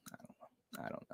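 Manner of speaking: breathed out, barely audible; whispered. Real, not acted. Streamer trailing off to chat, whispering. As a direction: let it fade into a whisper. Repeated I don't know, fading.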